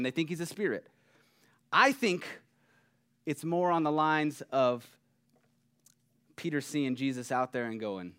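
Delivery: ordinary delivery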